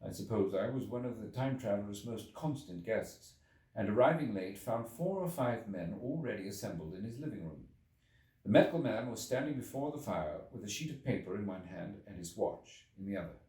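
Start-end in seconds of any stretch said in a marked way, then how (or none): none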